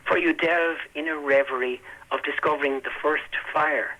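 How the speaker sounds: slow, measured reading of a poem; poetry reading. Acted. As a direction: read it slowly with long pauses. Poet reads his poem aloud.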